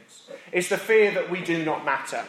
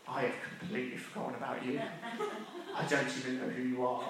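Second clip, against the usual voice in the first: sheepish thought; 3 semitones lower, 13 dB quieter, faster than usual. Retold or imitated. Server's thought, caught out.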